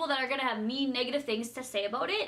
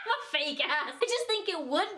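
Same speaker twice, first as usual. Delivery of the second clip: laughing; 6 semitones higher than usual. Real, not acted. Laughing at herself.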